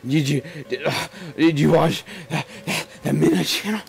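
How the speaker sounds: high, eager stammer; breathless excited friend; excited imitation. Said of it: "She'll be like".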